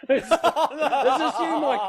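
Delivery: retold disbelief, cracking up; laughing accusation. Man accusing his joking mate on the phone, laughing disbelief.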